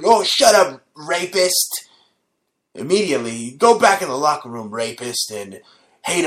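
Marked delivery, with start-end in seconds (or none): 0.0-1.9 mock retort. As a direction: shout him down. Retorting to voiced Roethlisberger.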